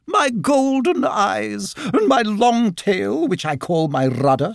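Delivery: high, drawn-out self-admiration; haughty otter. A narrator's reading. Otter showing off her eyes and tail, haughty. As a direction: linger grandly on each feature, pitched high.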